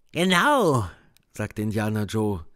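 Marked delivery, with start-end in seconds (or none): 0.1-0.9 a hint of a menacing man; lightly voiced tough man. A narrator's reading. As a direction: agree with menace. Indian Joe agrees.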